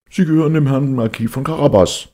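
high, loud, scared chorus; frightened reapers in chorus. Acted. Frightened reapers answer the king in a high, loud chorus. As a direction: shout the answer fast, fearing the cat. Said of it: "antworteten die Schnitter".

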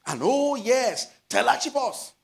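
high, slow, drawn-out "And oh yes" with wonder; excited reading. Shown as a demonstration. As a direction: read it as a thrilling find. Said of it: "When I saw it, I, I screamed".

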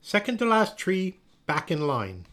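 high call settling into firm command; teacher's order. A narrator's reading. Miss Olga to Noelle out of line, firm order. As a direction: send a pupil back to place.

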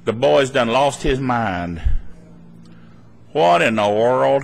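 low, slow head-shaking disbelief; mock bewilderment. Family reacting to the believer, low slow mock bewilderment. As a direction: drag it out in baffled dismay.

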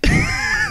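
short high-pitched laugh. Real, not acted. Laughing at odd account name.

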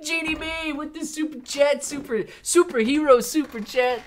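high, bright announcer call; hyped thanks. Real, not acted. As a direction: hype the super chat. Hyped super chat thanks.

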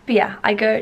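ordinary talk

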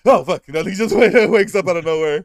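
ordinary talk, nothing marked

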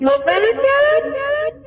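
very high, slow filtered voice; ghostly warble through the board; ghost voice effect. Pretend. Effect voice continues.

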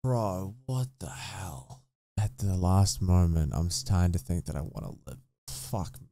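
choked up and crying quietly; real tears, voice low and soft. Real, not acted. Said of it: "I don't think I've cried this much".

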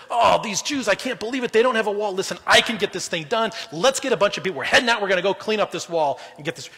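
rally the troops with proud overconfidence; a cocky leader barking orders to fix everything himself; proud take-charge boast. Retold or imitated. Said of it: "could have said".

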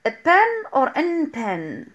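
very slow "A pen or an pen" to test the sound; comparing a pen and an pen. Shown as a demonstration. Teacher slowly testing a pen against an pen. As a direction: drag out "A pen" and "an pen" so students hear which fits. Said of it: "bien sûr a pen".

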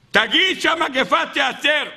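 high, drawn-out urging; urgent demand. Acted. Townsfolk beg him to end the plague, urgent demand. Pleading with the blesser to speak.

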